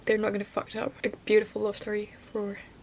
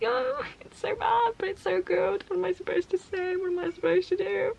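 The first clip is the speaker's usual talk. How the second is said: high-pitched, hurried mock wail; torn wailing; 7 semitones higher, 3 dB quieter than usual. Reader torn over a book both bad and good, wailing. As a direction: whine it helplessly, pitched up.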